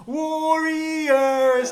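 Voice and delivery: high, loud drawn-out holler; yelling. Retold or imitated. Drunk friend hollering at a baseball game, yelling. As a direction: imitate a drunk fan bellowing a team name. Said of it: "He starts yelling".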